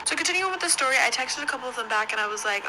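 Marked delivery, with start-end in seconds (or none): none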